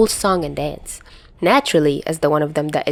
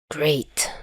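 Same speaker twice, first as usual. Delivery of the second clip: grumbled; 5 dB quieter than usual. A narrator's reading. Chuya grumbles after reading the message, whispered sourness. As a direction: grumble it under your breath. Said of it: "his partner grumbles".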